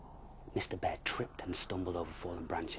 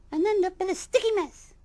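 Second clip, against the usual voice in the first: very high, loud grumbling bee; groaning bee; 12 dB louder than usual. Acted. Small bee doubting the bear's plan, high groan. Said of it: "groaned a small buzz".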